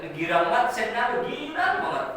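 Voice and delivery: rising to very high and loud, bursting with joy; joyful. A narrator's reading. Preacher telling how overjoyed Ali was, high and loud. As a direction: build the delight up and up.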